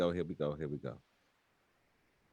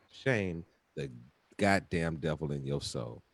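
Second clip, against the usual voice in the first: close-up plea; 10 semitones lower, 6 dB quieter than usual. Acted.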